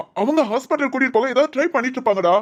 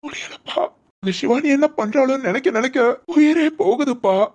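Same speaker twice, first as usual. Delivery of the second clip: anguished tearful plea to father; crying in anguish. Acted. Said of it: "நீ முத அழுகாத".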